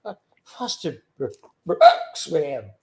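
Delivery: put-on halting drunk with a hiccup; Foster Brooks. Shown as a demonstration. Scammer-baiter playing drunk for a caller, slurred and halting. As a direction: stumble over the words like a comic drunk. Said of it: "I was gonna be".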